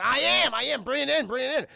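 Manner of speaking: high, loud, eager answer; urgent, thrilled shouting; excited dad. Retold or imitated. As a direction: imitate a man bursting to get his prize. Thrilled dad answers the delivery man.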